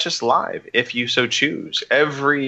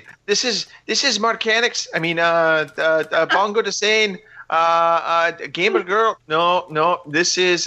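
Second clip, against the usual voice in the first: high-pitched, hammed-up Irish accent; mock Irish accent, lilting well above your usual voice; attempted Irish accent; 6 semitones higher than usual. Shown as a demonstration. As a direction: have a go at a cartoon cereal-mascot Irish brogue, pitched up and faltering. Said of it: "do my best Lucky Charms accent. I mean, Irish accent".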